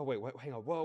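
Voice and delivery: ordinary talk, nothing marked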